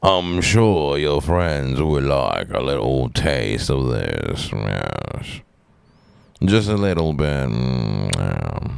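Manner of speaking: slow put-on Southern drawl, sinking very low; Southern accent. Coaxing friends to a little taste, mock Southern drawl. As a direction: draw it out Southern, endings dropping deep. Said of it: "what Southern".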